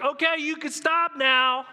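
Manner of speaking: high, loud, slow plea to stop; pleading to stop. Retold or imitated. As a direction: beg him to stop over the storm. Job begging amid the whirlwind.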